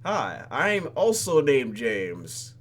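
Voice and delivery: slow, loud and exaggerated greeting; drawn-out intro. Real, not acted. Player introducing herself to the incubi, slow and loud. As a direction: stretch out every word. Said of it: "introducing herself, drawn out".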